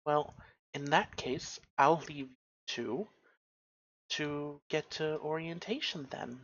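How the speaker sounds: soft sign-off, with "then." left hanging in the air; last word left hanging. Acted. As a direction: ease out quietly, letting "then." hang unfinished. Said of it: "leaving that last word hanging in the air".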